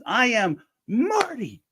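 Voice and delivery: high, slow, insistent; stubborn in-character reply. Retold or imitated. Stoltz answering Spielberg, high and slow, staying in character. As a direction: spell out the name like it's obvious. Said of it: "he says".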